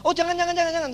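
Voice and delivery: acted alarmed objection; hasty protest. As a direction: blurt it out to stop him cancelling. Acted hasty plea not to cancel.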